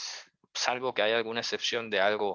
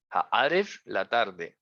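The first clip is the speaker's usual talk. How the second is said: ordinary talk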